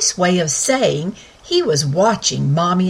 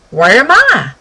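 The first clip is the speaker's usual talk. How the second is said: talking pony; 4 semitones higher, 8 dB louder than usual. Acted. Pony's first words.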